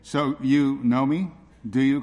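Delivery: slow, sceptical question with rising tune; ironic questioning. Acted. Jesus doubts the crowd's claim to know him, ironically. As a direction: challenge their claim with drawn-out irony. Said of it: "Jesus is speaking ironically".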